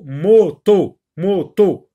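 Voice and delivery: teacher drilling "moto" syllable by syllable; repeated syllable drill. Shown as a demonstration. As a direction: repeat "moto" in two strong, separate beats. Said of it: "Répète le mot".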